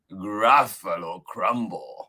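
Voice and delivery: slow, deliberate "Gruffalo Crumble"; drawn-out, threatening naming of "Gruffalo Crumble"; menacing mouse. Acted. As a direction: announce "Gruffalo Crumble" with relish, falling at the end. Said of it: "The mouse said".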